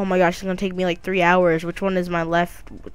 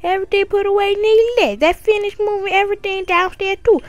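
high mom voice; 12 semitones higher, 5 dB louder than usual. Acted. Voicing the mom who welcomes Kyrie to the new room, in a high sweet voice. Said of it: "changing voices".

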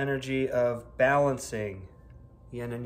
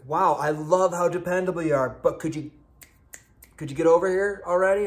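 louder, higher, mock-impatient plea; acted impatience; 5 semitones higher, 7 dB louder than usual. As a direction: hurry them up, loudly and playfully fed up. Acted impatient plea to slow sign.

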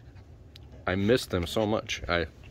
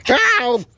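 sharp cry of pain; 13 semitones higher, 8 dB louder than usual. Real, not acted. Dog bites hand.

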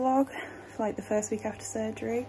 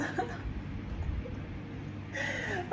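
airy giggling laugh; breathy laugh; 12 semitones higher, 10 dB quieter than usual. Real, not acted. Laughing at quick discharge.

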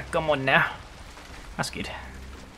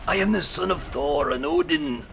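high, booming mock proclamation; Viking voice; 12 semitones higher than usual. Viking raider proclaims his lineage, grand mock battle shout. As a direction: declaim like a hammed-up Norse warrior. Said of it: "that's my Viking voice".

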